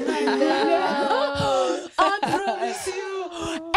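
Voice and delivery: half-crying laugh, slow and high. Real, not acted. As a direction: laugh through the promise. Laughing while insisting.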